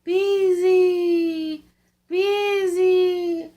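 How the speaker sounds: the wind's voice, high and loud; eerie moaning wind chant; howling wind. A narrator's reading. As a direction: howl it like a storm wind through the chimney. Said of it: "it went".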